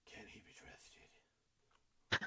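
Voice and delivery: slow, voiceless rasp; brooding whispered growl; gruff Batman voice. Acted. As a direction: drag it out in a hoarse vigilante whisper. Joins the gruff Batman voice joke.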